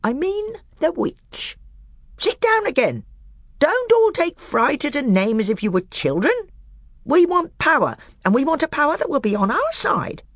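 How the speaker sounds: high, sly dwarf voice pitched well up; a bitter dwarf testing the council; high, crafty dwarf; scheming dwarf. Acted.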